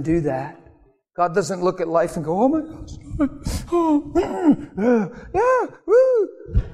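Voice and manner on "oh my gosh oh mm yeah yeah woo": hammed-up, very high-pitched fluster; mock agitated gawking. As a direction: play a worked-up onlooker, squealing high. Mocks God gawking at drama.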